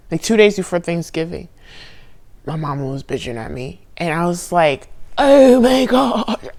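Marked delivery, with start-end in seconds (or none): 5.2-6.5 higher, drawn-out despairing groan; breaking down. Retold or imitated. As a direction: sink into despair. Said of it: "I really broke down".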